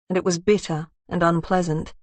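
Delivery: ordinary delivery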